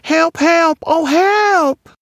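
loud, very high screams; wailing for help. A narrator's reading. Creature about to be caught, loud high wails. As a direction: shriek it like a terrified little creature. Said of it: "Its horrible mouth wailing".

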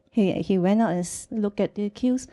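ordinary talk, nothing marked

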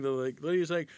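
normal talking voice

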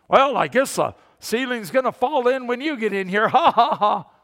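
loud, high joking jab with a laugh; mocking joke. Churchgoer joking at a returning visitor, mocking laugh. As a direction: laugh at your own dig. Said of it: "And it's funny to you".

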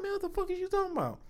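fast, soft, high-pitched protest; mock disbelief. Man retelling his reply in bed, mock disbelief. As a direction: rattle it off high, imitating bafflement.

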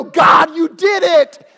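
loud high shouts giving God credit; praising shout. Pretend. Believers crediting God for salvation, loud high shouting. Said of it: "we go".

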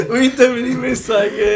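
helpless laughter breaking into the sentence; laughing. Real, not acted. Friend teasing the others about the muddled film plot, laughing. Amused group banter over confusion.

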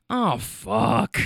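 lightly voiced grumpy old man; a hint of weary old-grump exasperation; grumpy old guy. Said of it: "this grumpy old guy's like".

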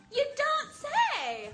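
slow, amazed you don't say; genuinely wowed by the news; surprised you don't say. Shown as a demonstration. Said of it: "表示惊讶".

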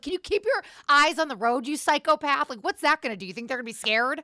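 loud, high-pitched panicky telling-off; exasperated scolding. Retold or imitated. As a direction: scold the reckless driver in alarm. Retells scolding a reckless driver.

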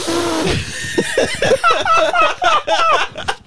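high, loud burst of laughter; a real, squealing laugh; shrieking laugh. Real, not acted. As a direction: cackle helplessly at the joke.

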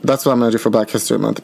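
ordinary talk

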